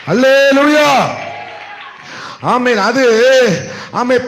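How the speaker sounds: loud, impassioned sermon delivery; full-force preaching. Acted. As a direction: proclaim the promise to the congregation with force.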